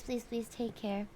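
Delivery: ordinary talk, nothing marked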